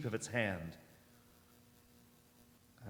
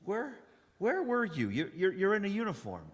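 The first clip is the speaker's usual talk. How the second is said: high, stammering surprise; startled stammer; 8 semitones higher than usual. Acted. Wanderer noticing the soldier's uniform, high startled stammer. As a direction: catch yourself, startled and high.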